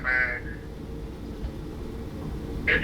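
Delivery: ordinary delivery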